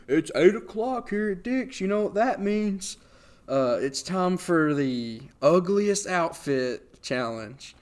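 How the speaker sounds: high, slow hyped host announcement; announcer voice. Retold or imitated. Staff announcing the ugliest outfit contest, high slow host voice. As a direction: announce it like a game show host.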